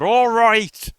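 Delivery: loud exasperated broad Irish "All right". Retold or imitated. Imitating the stressed chief's broad Irish outburst. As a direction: bark it out in a broad Irish accent. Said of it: "like, that broad".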